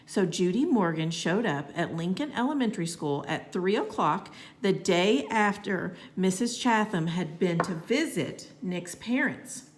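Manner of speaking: ordinary delivery, nothing marked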